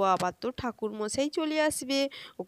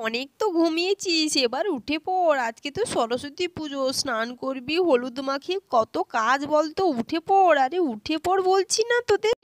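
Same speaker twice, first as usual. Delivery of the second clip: mild scolding, higher at the end; insistent waking call; 6 semitones higher, 4 dB louder than usual. Acted. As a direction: urge sleepy children to get up. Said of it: "তুমি এত চিৎকার চেঁচামেচি করছো কেন". (ঘুম থেকে তোলার বকুনি)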